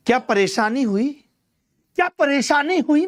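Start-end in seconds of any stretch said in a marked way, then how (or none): 2.0-3.1 high-pitched, insistent repeat of the question; higher, demanding "what went wrong?". Real, not acted. Teacher repeats the question with emphasis.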